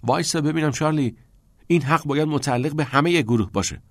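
a sharp protest to Charlie. A narrator's reading. Pitts protests to Charlie that the cave belongs to all, insistent. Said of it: "پیتس به اعتراض گفت".

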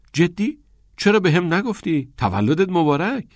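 high, eager congratulations; excited, lifted questions and good wishes; pleasant surprise. A narrator's reading. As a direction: react with delighted surprise to a friend's news. Friend learns of a birthday.

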